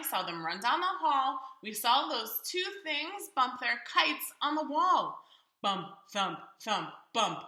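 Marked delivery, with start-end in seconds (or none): none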